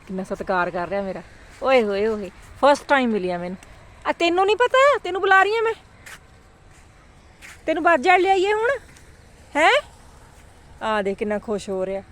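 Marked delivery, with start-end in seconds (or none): 4.1-9.8 talking to a pet. Real, not acted. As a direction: tease the dog playfully. Talking to the dog.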